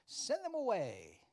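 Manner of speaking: high, loud, drawn-out dismissal; insensitive disciples. Retold or imitated. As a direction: send them off loudly, stretching the words. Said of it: "The insensitive disciples".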